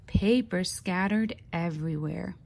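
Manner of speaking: ordinary delivery, nothing marked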